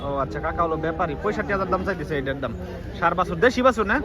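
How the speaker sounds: ordinary delivery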